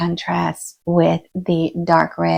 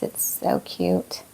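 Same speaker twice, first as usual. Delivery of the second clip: slow hushed whisper, much lower and softer; cooing over cuteness; 11 semitones lower, 8 dB quieter, slower than usual. Real, not acted. Creator to viewers over a tiny begonia leaf, slow hushed coo. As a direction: breathe it out softly, charmed by the little leaf.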